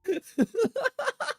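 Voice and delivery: real laugh; high burst of laughter. Real, not acted. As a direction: crack up at the joke. Laughing at chat's joke.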